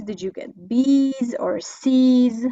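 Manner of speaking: ordinary talk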